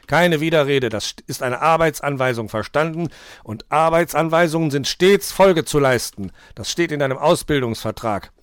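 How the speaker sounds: loud, insistent telling-off; stern scolding. A narrator's reading. Nikolaus reminds apprentice of work orders, sternly scolding. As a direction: lay down the rules forcefully.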